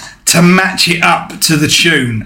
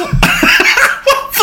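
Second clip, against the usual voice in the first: laugh. Real, not acted. Laughs at his own lyric.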